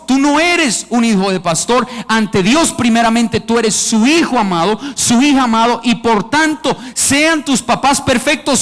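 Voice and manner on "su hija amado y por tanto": normal talking voice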